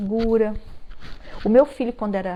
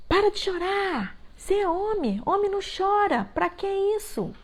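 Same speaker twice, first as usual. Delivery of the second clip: put-on higher, scolding a crying boy; mock harsh parent; 9 semitones higher than usual. Parent scolding crying son, mock harsh higher voice. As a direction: play the impatient parent telling the son to stop.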